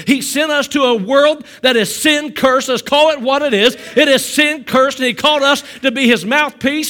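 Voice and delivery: fiery pulpit preaching; full-force preaching. Acted. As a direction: drive it home to the congregation. Preaching to the church.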